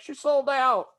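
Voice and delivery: high, mocking whine; mock your own complaint in a high whine; mocking whiny voice. Mocking own podcast opinion.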